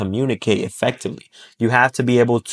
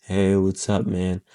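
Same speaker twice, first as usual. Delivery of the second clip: down, low energy; 4 semitones lower, 6 dB quieter, slower than usual. Shown as a demonstration. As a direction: lower and much softer, deflated greeting. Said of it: "by being all down".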